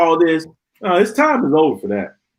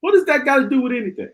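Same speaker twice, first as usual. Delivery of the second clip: exasperated; 7 semitones higher, faster than usual. Real, not acted. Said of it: "I shouldn't see that foolishness".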